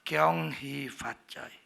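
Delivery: low, slow and drawn-out greeting; low Hokkien intonation. Shown as a demonstration. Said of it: "orang Hokkien ngomongnya itu rendah".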